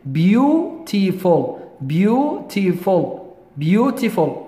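beautiful said slowly as beau-ti-ful, three clear syllables; careful syllables. Shown as a demonstration. Teacher modelling beautiful for students, slow and syllabic. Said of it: "لاحظ اللفظ زين".

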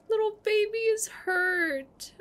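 high, pitying voice; sympathy for the kitten; high sorry lament; worried. Real, not acted.

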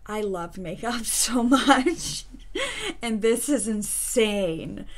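laughing. Real, not acted. Laughing about loving makeup.

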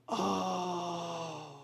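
soft breathy gasp then a hushed oh; stunned gasp. Retold or imitated. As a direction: catch your breath in awe. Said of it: "everybody instantly goes".

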